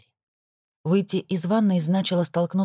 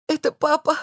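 high, tear-choked wheeze; wheezing through tears; 11 semitones higher, 3 dB louder than usual. Acted. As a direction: rasp it out crying. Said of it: "сквозь слёзы просипела я".

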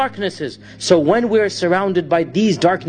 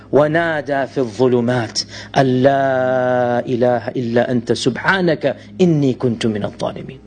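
Quranic recitation; 7 semitones lower, much faster than usual. Acted.

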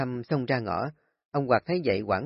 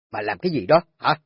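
sharp rebuke; 6 dB louder than usual. Acted. Husband snaps after broken teacups.

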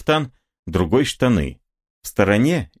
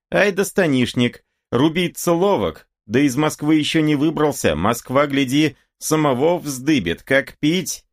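high-pitched, mocking admiration; cocky rival goading from afar; jeering boyar's son; 8 semitones higher than usual. A narrator's reading. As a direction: call after him in a high, lively voice. Boyar's son jeers after the Cossack.

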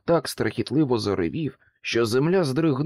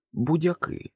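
usual speaking voice

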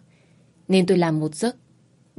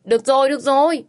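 loud, higher give-in to stop him; saying it loudly; 7 semitones higher, 4 dB louder than usual. A narrator's reading. Woman loudly gives in as he pulls her up, loud. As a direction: raise your voice to make him let go. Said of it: "nên tôi nói lớn".

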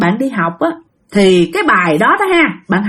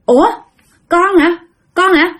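high-pitched startled questioning; dumbfounded; 7 semitones higher than usual. Acted. Aunt Bảy asks the spirit if it's hers, stunned. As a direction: ask it in stunned disbelief. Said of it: "dì Bảy mới ngớ người ra".

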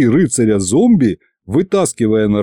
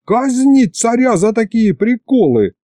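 furious shout; 11 semitones higher than usual. A narrator's reading. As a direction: bellow the sentence. Hero still yelling.

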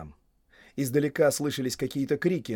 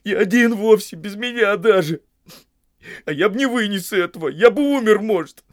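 high, loud tearful lament; weepy, plaintive reproach; sobbing; 9 semitones higher, 10 dB louder than usual. A narrator's reading. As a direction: pour out the hurt through sobs. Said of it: "всхлипнул Сэм".

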